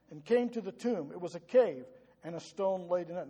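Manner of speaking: loud, forceful Bible reading; scripture reading. Acted. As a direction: read the verse out to the congregation with force. Reads scripture aloud.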